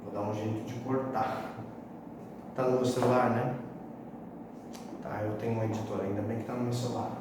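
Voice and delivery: low, soft off-script muttering; subdued behind-the-scenes aside; quiet aside. Real, not acted. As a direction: drop out of presenting, talk low to yourself. Off-script aside about cutting the botched take.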